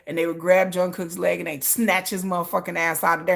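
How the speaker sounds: ordinary talk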